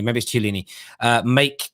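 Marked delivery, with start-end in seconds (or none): 0.3-0.7 "Chiellini" starting with "ch"; soft CH pronunciation. Real, not acted. Host settling on a version, "Chiellini" with a CH start. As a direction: settle on "Chiellini" as chil-LEE-nee, CH not K. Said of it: "I hear people say it differently".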